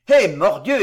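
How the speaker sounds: loud, hearty oath; Pardaillan bursting out. A narrator's reading. Pardaillan interrupts the girl's grief, loud outburst. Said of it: "éclata Pardaillan".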